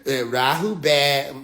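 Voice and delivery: low, very slow intonation; mock greeting. Woman greeting the aliens with Rahu bad, low slow drawl. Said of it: "She gotta be the motherfucker they greet".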